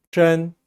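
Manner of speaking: 真 with a lightly retroflex zh; slightly curled tongue. Shown as a demonstration. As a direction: curl the tongue a little on 真. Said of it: "살짝 고그리고 발음합니다". (혀를 살짝 구부려 真 발음)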